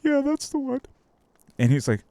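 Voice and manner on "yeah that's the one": high squeaky imitation of a young girl; daughter voice. Tween answering her dad at the store, high imitated voice. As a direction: put on a much higher kid's voice. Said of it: "and she goes".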